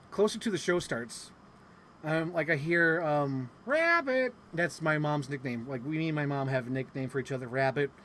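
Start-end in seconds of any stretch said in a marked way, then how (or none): 3.7-4.3 calling out. Retold or imitated. Mom calling nickname across hall.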